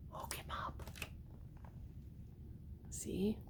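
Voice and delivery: hushed storybook whisper. A narrator's reading. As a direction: whisper it like a secret. Spooky storytelling moment.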